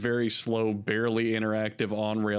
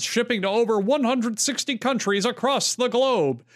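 big heroic trailer narrator voice, higher and building; comic book narrator; 9 semitones higher, 5 dB louder than usual. Shown as a demonstration. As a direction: announce it like a superhero movie. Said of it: "Do you hear my big comic book narrator voice right now?".

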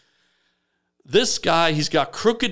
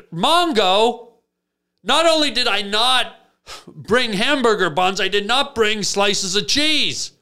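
1 exasperated; 6 semitones higher than usual. Retold or imitated. Exasperated at friend's demands.

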